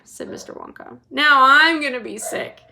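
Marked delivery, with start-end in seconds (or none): 1.1-2.6 louder, higher sick yell; yelling queasily. A narrator's reading. Missus TV frightened of a crash, queasy yell. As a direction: yell in nausea. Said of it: "yelled Missus T V".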